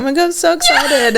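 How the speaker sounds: high, laughing gush; excited. Retold or imitated. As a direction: squeal with fake delight. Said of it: "you're like".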